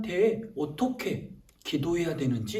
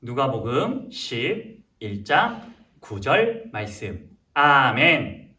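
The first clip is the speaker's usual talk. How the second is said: slow, measured Bible recitation; scripture reading; 3 semitones lower, slower than usual. Acted. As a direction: read it out deliberately for children to repeat. Leads children's scripture reading.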